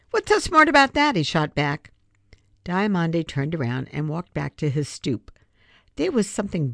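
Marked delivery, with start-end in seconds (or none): 0.1-1.1 high, loud snappy comeback; indignant retort. A narrator's reading. Boy snapping back at the girl who scolded him, high and loud. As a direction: fire it back fast and offended. Free shoots back at Diamande.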